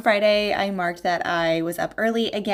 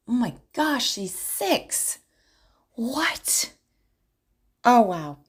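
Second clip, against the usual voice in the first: high-pitched gasping amazement, ending in a breathy whispered question; suddenly stunned, pitch leaping up, voice dropping to a whisper; real disbelief; 5 semitones higher, much slower than usual. Real, not acted. Aunt realizing her little niece is six, stunned disbelief.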